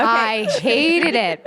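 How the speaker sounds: HATED stretched out very slowly; emphatic loathing. Real, not acted. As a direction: drag out the word "hated". Emphatic loathing of her music video.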